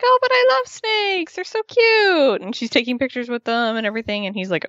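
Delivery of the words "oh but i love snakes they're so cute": very high, sing-song cooing over cute snakes; hammed-up squeaky adoration for a pet snake; mock cooing girl. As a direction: gush up high like a baby-talking snake lover. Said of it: "And she's like".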